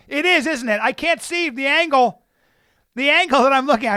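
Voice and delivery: high, loud and hurried to save face; flustered backpedal. Retold or imitated. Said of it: "I didn't know how to get out of my".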